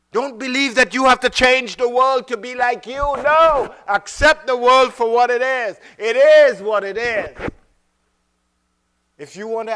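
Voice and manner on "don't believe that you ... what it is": high, loud preaching. Real, not acted. Preacher urging the congregation, loud and high. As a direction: drive the point home with force.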